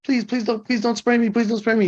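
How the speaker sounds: high, whiny mock begging; mock scared pleading. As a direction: imitate a scared guy pleading not to be sprayed. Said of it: "That's them acting scared".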